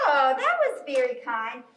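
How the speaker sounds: usual speaking voice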